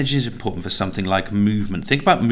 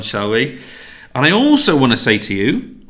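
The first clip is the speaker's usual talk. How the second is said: ordinary talk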